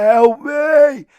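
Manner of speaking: very high, loud drawn-out scream; mock cry for help. Woman calling to the escaping boys, loud mock shriek. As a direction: imitate her screeching plea at full volume.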